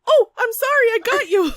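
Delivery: high, loud startled apology; flustered apology. Acted. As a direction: squeal out a startled sorry. GM voices Jess apologizing in fight.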